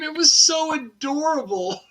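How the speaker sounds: real laughter about something adorable; laughing. Real, not acted. Laughing at the memory.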